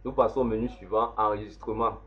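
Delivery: usual speaking voice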